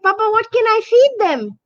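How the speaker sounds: put-on a child tugging her papa's sleeve; excited little girl. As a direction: ask like a thrilled little girl. And then she said.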